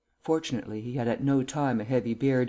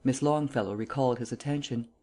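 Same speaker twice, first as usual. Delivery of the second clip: usual speaking voice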